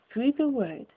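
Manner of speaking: ordinary delivery, nothing marked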